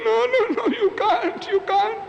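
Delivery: very high, tearful refusal with a laugh; hammed-up sobbing protest; grieving sheikh refuses the priest's sympathy, very high; mock weeping sheikh. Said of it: "says the sheikh".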